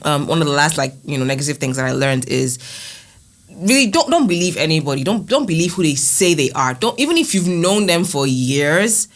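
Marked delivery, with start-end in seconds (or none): none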